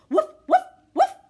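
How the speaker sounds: very high, loud yappy woofs; a kid pretending to be a puppy; puppy barks. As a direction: bark like an excited puppy. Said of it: "I bark".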